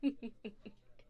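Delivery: short, amused little chuckle; light chuckle. Acted. Hostess amused by her guest's quick reading, light chuckle.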